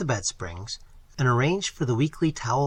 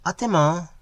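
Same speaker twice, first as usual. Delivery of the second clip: put-on higher, light girlish call; girl calling back; 5 semitones higher, 4 dB louder than usual. A narrator's reading. Girl returns the greeting, light higher call. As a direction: answer him in the same breath, brightly. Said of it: "she cried".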